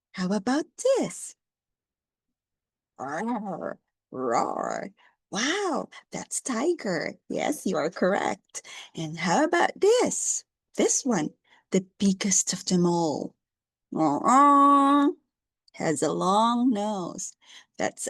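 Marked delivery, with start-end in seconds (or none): 3.0-4.9 tiger roar. Shown as a demonstration. Teacher roars softly as a tiger for James. 14.0-15.1 loud high trumpeting call; elephant sound. Shown as a demonstration. Teacher trumpets like an elephant for James.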